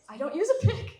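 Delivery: laughing. Real, not acted. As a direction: laugh right through the answer. Amused at interviewer's wrong assumption.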